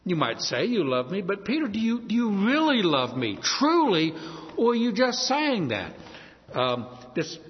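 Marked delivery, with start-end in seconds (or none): 1.2-5.9 "really" and "truly" pitched high and pressed; stress on REALLY, TRULY. Shown as a demonstration. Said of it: "maybe add a word".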